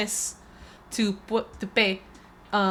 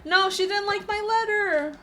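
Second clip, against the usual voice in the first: much higher, slowed dismayed outcry; high drawn-out mock dismay; dismayed cry; 13 semitones higher, 3 dB louder than usual. Real, not acted. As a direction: wail at the rejection. Dismayed outburst.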